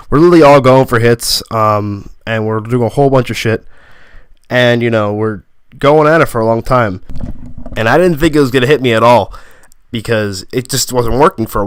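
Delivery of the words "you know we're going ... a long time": ordinary delivery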